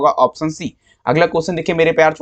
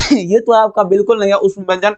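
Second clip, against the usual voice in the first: laughing; 3 semitones higher than usual. Real, not acted. Laughing at the wrong option.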